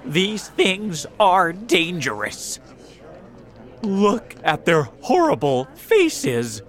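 high, loud cries from a frightened crowd; horrified cry. A narrator's reading. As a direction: warn everyone in dread. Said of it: "the officers and firefighters cried".

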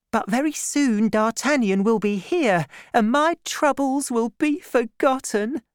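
high, bright joyful voice; hopeful young woman. A narrator's reading. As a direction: lift it with hope. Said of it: "said Madame Bonacieux".

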